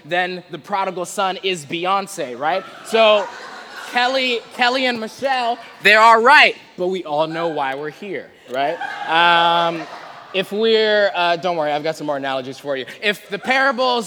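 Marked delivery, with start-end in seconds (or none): none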